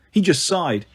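ordinary delivery

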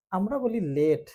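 usual speaking voice

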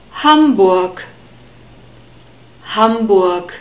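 Hamburg with short U, R softened to an unstressed vowel, G as K; German pronunciation. Shown as a demonstration. Teacher demonstrates the sounds just explained, Hamburg said the German way. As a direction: stress Ham in Hamburg and close on a K. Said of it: "the R is pronounced like an unstressed E".

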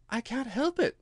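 high, small, meek voice. A narrator's reading. Alice answers the squashed Dormouse, very meek and high. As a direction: answer meekly, without any force. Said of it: "said Alice very meekly".

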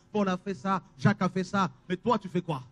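usual speaking voice

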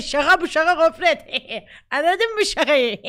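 playfully telling the dog it was only a joke, laughing; mock playful scolding. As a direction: tease the dog through laughter, half-joking. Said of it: "falan gibi bir olay".

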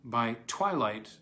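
usual speaking voice

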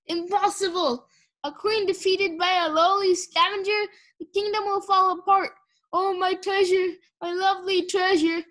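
high-pitched dying-queen wail, hammed up; melodramatic lament. Sunny plays the defeated queen dying, melodramatic lament.

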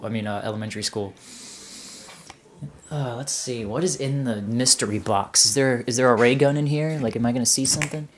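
normal talking voice

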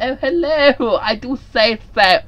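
high, loud hammed-up toff; mock posh gent. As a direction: camp it up like an old English gentleman. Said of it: "we talked like this".

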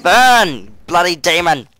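loud cry of burn, bloody demon; battle shout. Real, not acted. Gamer fighting a demon, loud battle shout. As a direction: yell it at the monster.

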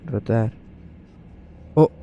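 ordinary delivery

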